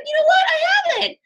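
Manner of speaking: put-on lightly shouted toddler voice; imitating son. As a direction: kid cheerfully discovering it, hammed up. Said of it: "he's like".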